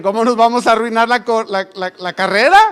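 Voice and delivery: loud, high, indignant stammering protest; exasperated outburst. Retold or imitated. Father protests to his son about ruining the run, in a loud indignant voice. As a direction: protest in mock outrage.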